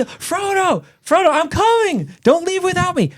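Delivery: very high, drawn-out cry after someone leaving; mock desperate calling. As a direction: call out in comic panic, pitch way up. Sam calls after departing boat.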